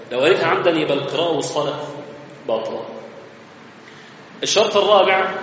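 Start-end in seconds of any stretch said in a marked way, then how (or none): none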